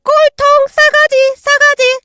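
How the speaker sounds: high bird screaming at full voice; loud parrot squawk. Acted. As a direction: shriek it like a parrot. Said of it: "목청껏 외치고".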